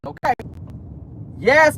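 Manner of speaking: normal talking voice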